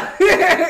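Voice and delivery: a sudden loud, high burst of laughter; real laughter breaking out. Real, not acted. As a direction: crack up loudly.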